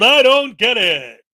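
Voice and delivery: loud title announcement, I Don't Get It boomed; announcing a title. Shown as a demonstration. Streamer naming his bit to viewers, loud announcer. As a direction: boom it like a show title. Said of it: "This is called".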